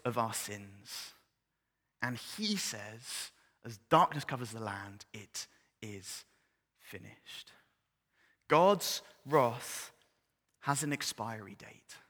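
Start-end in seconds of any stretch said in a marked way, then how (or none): 5.1-7.5 soft, hushed last words. Acted. Jesus on the cross as darkness falls, hushed. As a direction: let it fall to a whisper.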